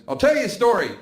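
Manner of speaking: high, loud acted announcement; loud offer called after him; grandma calling out. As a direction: imitate grandma hailing her grandson. Grandmother calls after departing grandson.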